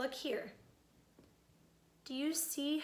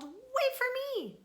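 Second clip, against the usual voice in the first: high, eager pleading small-fish voice; little blue fish begging Rainbow Fish for a scale; 8 semitones higher, 5 dB louder than usual. Acted. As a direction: beg sweetly for a shiny scale.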